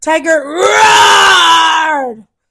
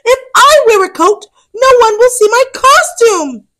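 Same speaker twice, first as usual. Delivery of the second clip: put-on high, louder complaining kid; upset whining; 9 semitones higher, 7 dB louder than usual. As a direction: whine it at your mother. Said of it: "very upset".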